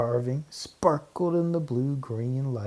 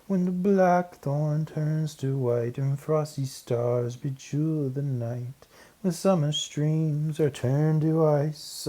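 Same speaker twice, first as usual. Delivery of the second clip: higher, slow, measured verse recital; solemn recitation; 5 semitones higher, slower than usual. Acted. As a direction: recite the rhyme with ceremony, pausing before the last word. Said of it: "and recited".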